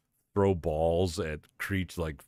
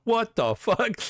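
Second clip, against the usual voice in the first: high, louder yelp of shock; shocked outburst; 5 dB louder, slower than usual. Pretend. Bystander catching wrestlers kissing at the salad bar, shocked squeal. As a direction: squeal it like you just walked in on something.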